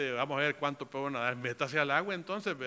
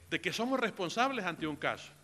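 usual speaking voice

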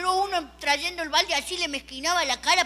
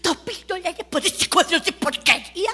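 indignant rant; 5 semitones higher, 4 dB louder than usual. Acted. Father outraged at the price.

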